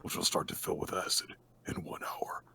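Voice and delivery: hushed, whispery villain voice; sinister half-whispered instructions; creepy killer laying out the game; Jigsaw. Acted. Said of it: "would you have the more appropriate, uh, Jigsaw voice".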